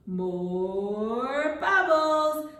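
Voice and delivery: drawn-out, half-sung prompt; slow, stretched out. Shown as a demonstration. Teacher prompting children to ask for bubbles, slow and stretched. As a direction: stretch it long and lift the pitch. Models request for children to repeat.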